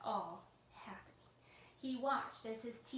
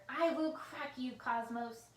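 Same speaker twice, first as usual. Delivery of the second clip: angry threat; 3 semitones higher, 8 dB louder, slower than usual. A narrator's reading. Villain vowing to crack the computer, loud angry. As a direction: vow it through clenched rage.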